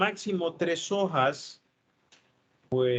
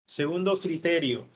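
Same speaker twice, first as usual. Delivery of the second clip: normal talking voice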